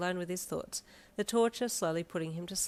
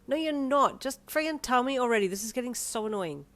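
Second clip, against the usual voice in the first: higher, louder annoyed huff; huffy; 6 semitones higher, 5 dB louder than usual. A narrator's reading. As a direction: huff it out, fed up. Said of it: "you huffed".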